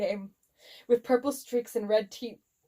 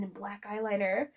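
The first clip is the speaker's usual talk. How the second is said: ordinary delivery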